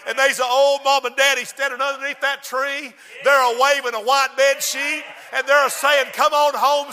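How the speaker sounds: rising joyful telling; building excitement. A narrator's reading. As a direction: let the joy swell. Story climax, building.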